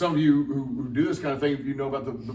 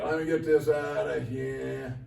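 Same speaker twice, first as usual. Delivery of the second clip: put-on Jersey accent; 3 semitones lower, 4 dB quieter than usual. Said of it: "I'm not sure where the Jersey accent came from".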